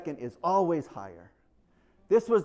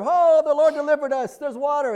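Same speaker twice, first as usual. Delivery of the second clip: higher, louder, overjoyed; joyful exclamation; 10 semitones higher, 8 dB louder than usual. Retold or imitated. Israelites rejoice at finding water, higher and louder with joy. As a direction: burst out in relief.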